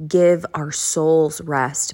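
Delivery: ordinary talk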